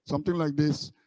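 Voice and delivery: normal talking voice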